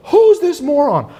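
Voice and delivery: shrieky mock fury at the book; mock furious outburst. Friend insulting the book's author, loud mock indignant outburst. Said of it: "She flipped out at a dinner".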